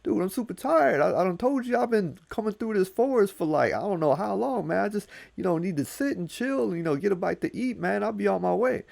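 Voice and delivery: high, pleading trickster voice; a high, wheedling Anansi; Anansi begging. Acted. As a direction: whine for water and food like a tired traveler. Anansi's begging voice to old man.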